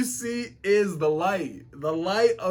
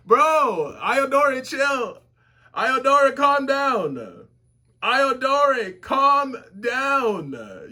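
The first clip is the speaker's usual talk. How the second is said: higher, louder, urgent calls to calm down; excited pleading; 5 semitones higher, 4 dB louder, slower than usual. Real, not acted. Viewer pleading with an angry character, high and loud. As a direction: plead excitedly with him to chill.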